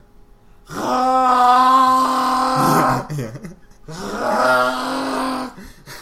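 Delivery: long, loud wailing creature roar; Chewbacca roar. Acted. Friend dares him to voice Chewbacca, loud roar. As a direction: roar like a big hairy alien. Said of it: "All right, Chewbacca".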